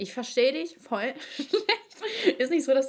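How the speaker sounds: laughing through the words. Real, not acted. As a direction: giggle mid sentence. Laughing at bad connection.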